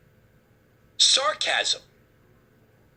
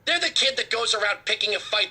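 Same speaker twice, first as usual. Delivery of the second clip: ordinary talk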